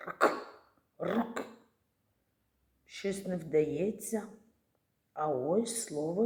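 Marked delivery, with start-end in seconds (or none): 0.1-1.5 "Рк" said with no vowel at all. Shown as a demonstration. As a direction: squeeze out "Рк" as bare consonants. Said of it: "спробуємо сказати це слово без голосного". (вимова "Рк" без голосного)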